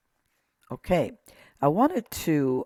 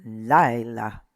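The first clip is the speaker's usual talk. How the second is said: "Laila" with a long, strong first syllable, LAI; stressed syllable; 4 dB louder than usual. Shown as a demonstration. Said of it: "Emphasis right here".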